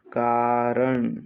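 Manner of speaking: slow, long-vowelled कारण; drawn-out syllables. Shown as a demonstration. Teacher dictating to students, कारण slowly stretched.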